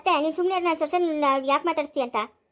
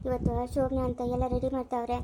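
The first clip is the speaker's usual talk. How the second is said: ordinary talk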